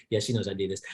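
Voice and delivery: hushed aside. Retold or imitated. Quoting man, hushed aside.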